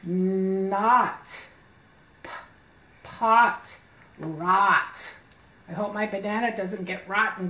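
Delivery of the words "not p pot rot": each word spoken slowly and clearly; sounding out words. Shown as a demonstration. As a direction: sound out every word alone, like for a child. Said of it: "Let me hear you".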